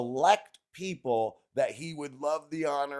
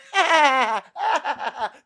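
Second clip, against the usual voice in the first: a burst of loud laughter; 13 semitones higher, 10 dB louder than usual. Real, not acted. Laughing at the stoned Canadians, loud burst. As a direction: crack up loudly.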